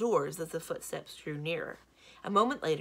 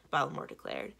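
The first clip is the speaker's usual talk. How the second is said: ordinary delivery, nothing marked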